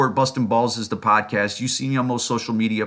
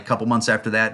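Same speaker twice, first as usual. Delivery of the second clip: usual speaking voice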